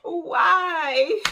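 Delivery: high, loud wail of why; despairing cry. Retold or imitated. Watching girls toss her candy, wailing why. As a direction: cry out to nobody in dismay.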